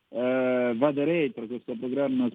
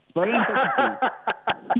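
Calabrian dialect; 6 semitones higher, slower than usual. Pretend. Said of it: "ti posso parlare in calabrese".

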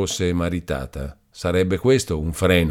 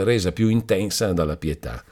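usual speaking voice

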